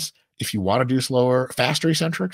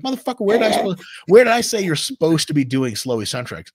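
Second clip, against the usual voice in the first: higher and louder, mock exasperation at a nitpicker; mock exasperated outburst; 6 semitones higher, 5 dB louder than usual. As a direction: fire back at the nitpicker in mock outrage. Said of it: "And I'm like".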